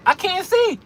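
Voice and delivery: high-pitched mock panicked cry; exaggerated, high-pitched alarm. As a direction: act out a driver blinded by fog. Acting panicked drivers in fog.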